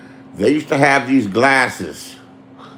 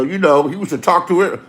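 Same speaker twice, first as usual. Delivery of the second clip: usual speaking voice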